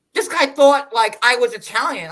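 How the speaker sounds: high, loud retold grumble; mock complaint. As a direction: pitch it up in mock indignation. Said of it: "'cause then he's like".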